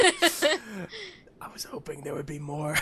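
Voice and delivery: slow whispered aside. Real, not acted. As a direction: breathe it out as a secret. Whispered aside after the trick.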